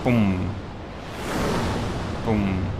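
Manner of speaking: low, soft satisfied boom; quiet. Real, not acted. Gamer watching the enemy die, soft and low. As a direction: murmur the hit.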